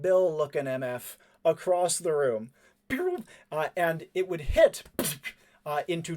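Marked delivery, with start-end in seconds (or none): 2.9-3.2 very high mouthed gunshot; little pew noise. Acted. Game master firing a cartoony bullet across the room, high pew. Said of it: "like, a little pew".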